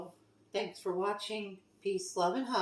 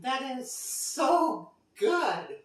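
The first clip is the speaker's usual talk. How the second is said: slow, high, drawn-out rave; savoring delight; 6 semitones higher, much slower than usual. Real, not acted. Cook tasting their own calzone, savoring delight. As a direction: stretch it out, blissfully impressed by the taste.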